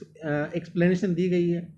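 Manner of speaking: ordinary talk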